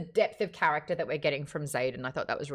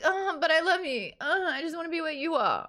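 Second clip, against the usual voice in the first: mock swooning heroine; 9 semitones higher than usual.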